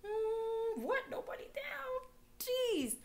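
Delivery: high, stunned voice with a whispered middle; stunned disbelief. Retold or imitated. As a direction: gasp at it like you can't believe your ears. Her stunned reaction to offer.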